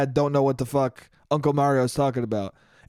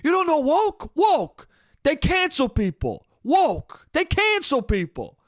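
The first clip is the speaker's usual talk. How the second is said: imitating uncle's shouting; 9 semitones higher, slower than usual. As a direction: keep hammering the word at them. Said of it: "he's just shouting".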